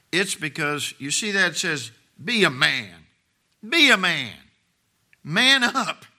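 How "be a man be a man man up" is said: building to a loud, high command; forceful exhortation. Acted. Preacher exhorting the men to man up, forceful and building. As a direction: urge it harder each time, rising to a shout.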